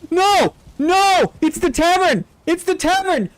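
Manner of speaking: gasping, thrilled screams of disbelief; excited shriek. Real, not acted. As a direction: shriek it like you just spotted something you love. Streamer recognises beloved tavern.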